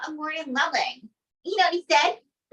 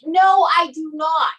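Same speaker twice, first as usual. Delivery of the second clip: loud, slow flat no; loud refusal; 3 semitones higher, 6 dB louder, slower than usual. Acted. Interviewer refusing Trump's crude offer, loud and slow. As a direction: shut him down firmly.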